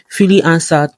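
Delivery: ordinary delivery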